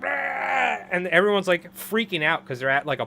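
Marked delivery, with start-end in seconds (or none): 0.0-0.8 sudden high roaring yell; jump-scare roar from inside the couch; imitated monster roar. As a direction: burst out with a mock monster roar. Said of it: "He's like".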